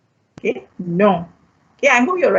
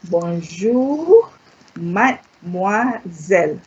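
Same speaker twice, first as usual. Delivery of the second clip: slow French demo; 2 semitones lower, much slower than usual. Shown as a demonstration. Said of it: "teacher models phrase slowly".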